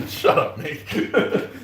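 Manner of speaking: slow words breathed through laughter; laughing. Real, not acted. Laughing as she tells Mick to shut up.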